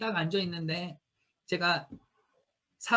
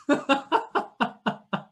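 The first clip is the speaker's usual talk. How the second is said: laugh; 8 semitones higher than usual. Real, not acted. Laughing at the story.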